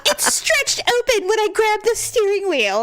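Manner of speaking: high-pitched whiny husband imitation; mock whining complaint. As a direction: wail it like a self-pitying patient.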